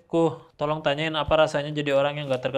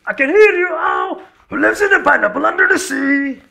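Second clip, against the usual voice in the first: very high, loud slow captain singsong; hammed-up high pirate chant; cartoon captain; 14 dB louder, slower than usual. Singing SpongeBob intro as captain.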